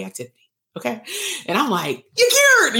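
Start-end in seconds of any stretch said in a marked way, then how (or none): 2.2-2.8 very high, loud mock announcement; squealed mock celebration; gleeful proclamation. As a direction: shriek it like a miracle cure.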